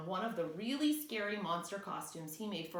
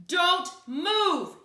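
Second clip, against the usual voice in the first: high, loud barked order; shout; 10 semitones higher, 10 dB louder than usual. Acted. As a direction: yell it to freeze him. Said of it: "he shouted".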